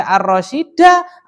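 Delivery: "Ar-Rasyida" with a long final a in place of u; wrong a ending. Shown as a demonstration. Teacher shows the class a forbidden reading, "Ar-Rasyida" ending in a. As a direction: end "Ar-Rasyida" on a stretched a. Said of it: "nggak boleh dibaca".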